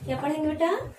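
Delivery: ordinary delivery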